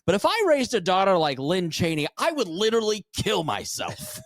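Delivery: imitated pundit getting worked up; Tucker Carlson. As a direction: jump up in pitch, mock-indignant pundit. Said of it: "That's a good Tucker Carlson impersonation".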